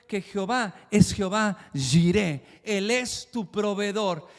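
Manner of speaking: fiery declaration; forceful preaching. Acted. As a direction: thunder out God's name.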